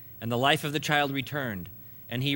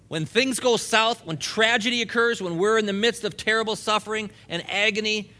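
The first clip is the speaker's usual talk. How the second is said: preaching; 5 semitones higher, 4 dB louder than usual. Real, not acted. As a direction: push it out like a pulpit climax. Preacher building intensity.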